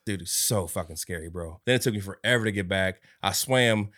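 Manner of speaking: ordinary talk, nothing marked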